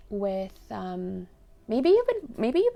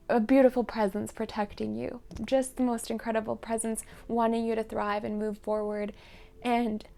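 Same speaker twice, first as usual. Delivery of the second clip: usual speaking voice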